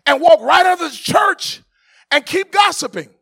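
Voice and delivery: loud, high preaching that builds to a shout; rising fervour, half sung, calling out to the church; preaching at full force. Acted. Preaching climax.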